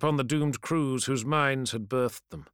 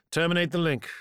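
raised, louder cold order; curt command; 6 semitones higher, 4 dB louder, faster than usual. Acted. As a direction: cut the call off coldly. Ordering the servitor curtly.

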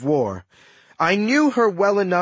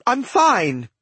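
high, sharp retort; testy cut-off, voice raised in pitch; snapped; 8 semitones higher than usual. A narrator's reading. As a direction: snap it defensively, pitch jumping up. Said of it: "she snapped".

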